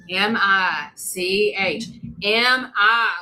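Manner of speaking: "M I C H" spelled out very slowly letter by letter; slow spelling to grandma. Retold or imitated. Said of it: "like I'm talking to somebody's grandma".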